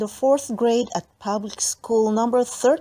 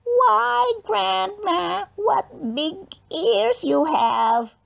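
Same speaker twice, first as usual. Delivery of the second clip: high mocking squeal at the new girl; squealing bully girl; 11 semitones higher, much slower than usual. A narrator's reading. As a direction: squeak it out high and slow to taunt her. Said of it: "Smoky Joe who squealed".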